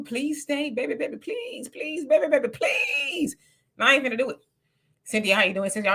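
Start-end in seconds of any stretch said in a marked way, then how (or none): 0.0-3.4 soft, whiny begging that slows to a fake cry; mock pleading. Host begs a leaving viewer to stay, mock crying. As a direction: beg them to stay, hamming up the tears.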